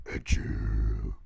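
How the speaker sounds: very low, loud villain voice; sinister villain echo. Acted. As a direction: drop way down like a menacing villain. Villainous echo of the narrated words.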